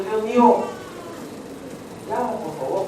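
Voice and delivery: ordinary talk, nothing marked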